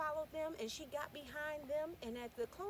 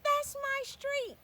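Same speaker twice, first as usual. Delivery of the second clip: high and loud, slowing at the end; a girl urging them on toward home; loud eager little girl; excited child; 11 semitones higher, 11 dB louder, slower than usual. Acted. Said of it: "She said".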